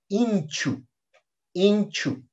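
"inchu" with i as in Spanish. Shown as a demonstration. Teacher showing the letter I, Spanish-like i. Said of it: "tan similar como en el castellano".

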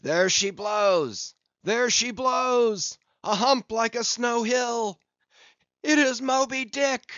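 high, loud, long-drawn lookout's shout; gull-like cry. A narrator's reading. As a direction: sing it out far across the water. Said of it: "he raised a gull-like cry in the air".

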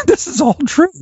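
high-pitched, laughing aside; laughter breaking into the words. Real, not acted. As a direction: insist on it through real laughter. Storyteller laughing at own tale.

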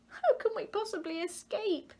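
high, small and worried, a little creature voice; small frightened fish voice. Acted. Tiny fish asks how they can escape, in a high small voice. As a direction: pitch it up like a tiny scared fish.

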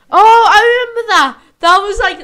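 light up at a happy memory; excited. Real, not acted. Excited recollection.

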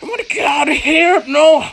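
high, wailing frustrated shout; panicked scream. Real, not acted. Player wants out of the game, panicked scream. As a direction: cry out desperately in a high voice.

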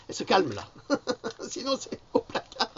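high, loud mock warning with giggles; high laughing mock-stern voice to Louloute; playful scolding. Real, not acted. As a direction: tease her with a pretend threat.

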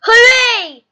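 very loud, high hooray; cheering. Acted. Peppa celebrates Mummy Pig's win, cheering loudly. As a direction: let out a big, high cheer. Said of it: "cheers Peppa".

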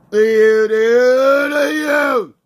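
loud yell climbing in pitch; taunting shout. Acted. Player yelling at his target, rising shout.

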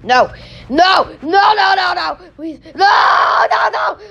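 pleading shouts. Real, not acted. Block slipping, pleading.

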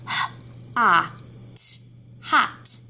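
teacher modelling phonics sounds of hot; sounding out. Shown as a demonstration. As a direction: sound out hot letter by letter, H, ah, t, then blend hot. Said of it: "Let's do the sounds of hot".

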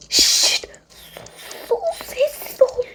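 hushed, breathy shushing; urgent whisper. Acted. A kid hushing friends in a dark hall, whispering.

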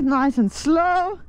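drawn out slowly and calmly; slow and easy. Real, not acted. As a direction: ease the words out, slowly. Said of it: "I'm just going nice and easy".

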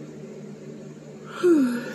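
big yawn. Real, not acted. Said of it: "I hope that yawn was contagious".

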